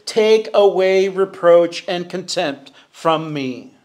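slow, pleading prayer, TAKE leaned on; slow prayerful plea. Acted. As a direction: pray it slowly as the psalmist. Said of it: "and so he prays".

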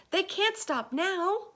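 high, loud, drawn-out insistence; slow high-pitched yell; urgent shout. A narrator's reading. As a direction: shout it back down firmly. Urgent order shouted back below.

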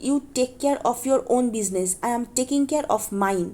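slow, each word pressed out in anger; angry, deliberate. Acted. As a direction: spell it out slowly through rising anger. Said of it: "সে নিজেও রেগে যায়".

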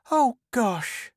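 put-on high child's voice, falling in dismay; dismayed little boy. A narrator's reading. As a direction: let it sink in like a crestfallen son. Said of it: "He read the look".

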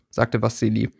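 usual speaking voice

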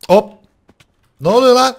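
surprised shout. Real, not acted. Sudden attack in game.

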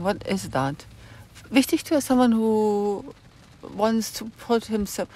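ordinary delivery

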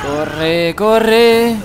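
being chased. Real, not acted. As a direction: shout it as it closes in.

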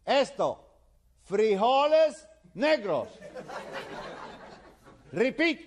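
vieux qui hurle comme à un sourd, très aigu; cri. Acted. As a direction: shout each word slowly and high, drilling a foreigner. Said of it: "le sigue gritando".

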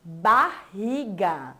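Barriga drawn out very slowly, every syllable clear; slow word model. Shown as a demonstration. Teacher to children finishing the word, slowly drawn out. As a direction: stretch Barriga so the kids hear each part. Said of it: "olha o G aí e o A".

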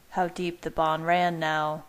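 ordinary delivery

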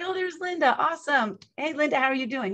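high, bright imitation of Jeff's happy hello; delighted greeting. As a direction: mimic a friend thrilled to see you walk in. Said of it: "He's like".

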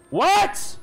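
very high, loud startled what; jolted by a scare; startled shriek. Real, not acted. Sudden scare in game.

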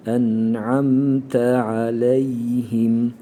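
slow chanted En'amte aleyhim; slow recitation. Shown as a demonstration. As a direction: recite it long and melodic. Recitation demo.

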